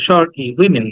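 "উইমেন" as wi-min with a brief i; teacher's model of short-vowel "উইমেন". Shown as a demonstration. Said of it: "ই বলতে হবে".

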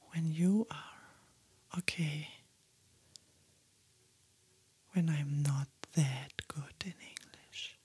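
soft whisper close to the mic; ASMR whisper. Acted. Whispering her makeup steps to ASMR viewers, soft whisper. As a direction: whisper gently, as if sitting right beside them.

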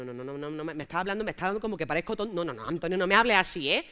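ordinary talk

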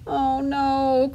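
high, mildly wailing cry; tearful quack. A narrator's reading. Duckling learns feather is gone, tearful quack. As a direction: wail it lightly, near tears. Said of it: "quacked Dilly, and she burst into tears".

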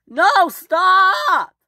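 very high, loud frantic stop; frantic shout. Acted. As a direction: yell to halt him before disaster. Warning Fergus on the bridge.

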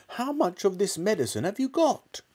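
high, eager father's voice; excited demand. Acted. Mister Cranky asking George about the medicine, high and eager. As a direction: press him for the answer eagerly. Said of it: "Mister Cranky shouted".

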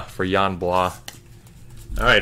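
normal talking voice

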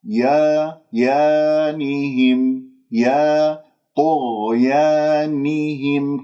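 slow يا with dropped jaw and relaxed tongue; open alif. Shown as a demonstration. Teacher demonstrates jaws apart, slow chanted correction. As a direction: sustain a pure open aa through طغيانهم. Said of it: "أبعد بين الفكين واللسان مرتاح".

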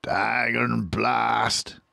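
tired, cross farmer cursing slowly; Boggis grumbling. A narrator's reading. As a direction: drag out a sulky curse. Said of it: "said Boggis".

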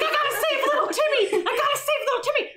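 hammed-up hero rushing to the rescue; laughing mock-urgent hero voice; mock heroic cry.

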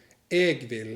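"เอกวิล" said slowly as "eg vil"; dialect variant "eg vil". Shown as a demonstration. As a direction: draw out "เอกวิล" carefully. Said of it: "พวกเขาจะพูด". (ออกเสียง "เอกวิล" ช้าๆ ชัดๆ)

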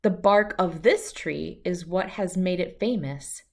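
ordinary delivery

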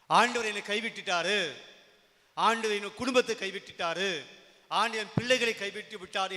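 loud, high cry of someone abandoned; despairing complaint. Acted. Voicing a despairing believer's complaint. (விரக்தியான உரத்த முறையீடு)

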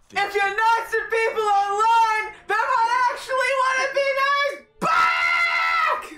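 mock-sobbing yell begging for kindness; crying shout. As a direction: wail it like a desperate sob. Wailing plea to gamers.

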